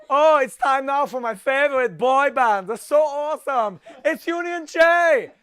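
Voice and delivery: high, loud camp German gushing; camp German accent. Retold or imitated. Mimicking Louis Walsh introducing his favourite boy band, camp German. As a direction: gush like a camp German show host. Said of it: "increasingly sound like a Camp German guy".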